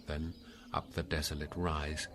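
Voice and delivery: ordinary delivery, nothing marked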